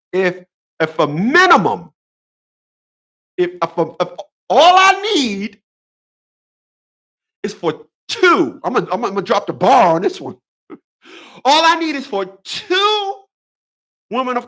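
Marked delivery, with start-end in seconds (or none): 4.5-8.6 much higher, slow and stretched: "all I need"; high drawn-out tease. Acted. Comic buildup, high drawn out. 11.4-14.5 much higher, sing-song delivery; high teasing punchline. Acted. Comic punchline, high pitch.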